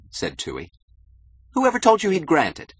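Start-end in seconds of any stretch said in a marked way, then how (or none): 1.5-2.7 very high, loud, incredulous question; agitated challenge. Acted. Keating challenges Toohey about the appointment, agitated. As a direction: throw it back in high-pitched disbelief.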